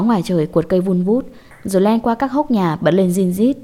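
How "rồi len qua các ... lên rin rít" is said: usual speaking voice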